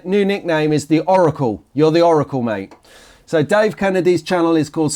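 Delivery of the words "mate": ordinary delivery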